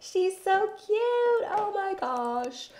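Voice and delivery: very high, slow gushing; drawn-out cutesy coo; adoring squeal. Real, not acted. Gushing over the toddler.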